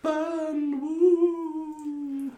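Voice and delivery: very high, hooting wraith; ghostly voice. Acted. Marsh wraith hinting to adventurers about fire, high ghostly wail. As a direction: wail it high like a spook, long oohs. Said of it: "in his ghostly voice".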